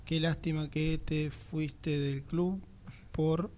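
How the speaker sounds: slow, word-by-word dictating; slow dictation. Real, not acted. Dictating a message to the ex-player, slowly. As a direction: spell it out slowly as you type.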